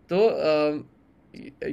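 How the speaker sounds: usual speaking voice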